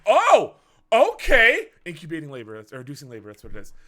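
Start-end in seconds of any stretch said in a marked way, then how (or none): 0.1-1.7 loud, high startled gasp of words; jolted by sudden news; shocked oh okay. Retold or imitated. Hearing the baby is coming now, loud and startled.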